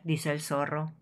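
ordinary delivery

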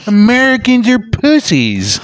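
put-on high, drawn-out girlfriend voice; mock goading. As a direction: goad him in a slow, put-on high voice. Still playing Putin's girlfriend.

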